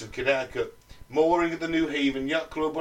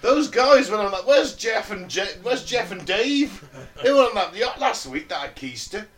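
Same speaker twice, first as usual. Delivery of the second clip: raised, worried local asking around; local sailor voice; 8 semitones higher, 4 dB louder than usual. Retold or imitated. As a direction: call out like a puzzled dockside local.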